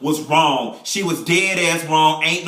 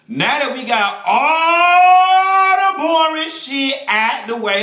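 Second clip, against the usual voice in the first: hammed-up crying wail, higher, slow and louder; mock wail; 8 semitones higher, 4 dB louder, slower than usual. Mock-wailing as the recap turns to gossip. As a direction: drag it out like a fake sob.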